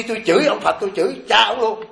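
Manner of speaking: normal talking voice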